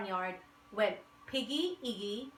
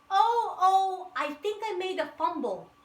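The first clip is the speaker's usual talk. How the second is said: dismayed oops; 10 semitones higher, 6 dB louder than usual. A narrator's reading. Weasel falls through the thicket.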